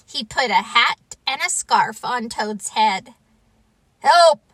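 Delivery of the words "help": loud, higher single yelp; cry for help. Acted. Toad smothered in winter clothes, crying out. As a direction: yell out for rescue. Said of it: "cried Toad".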